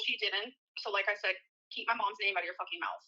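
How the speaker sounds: ordinary delivery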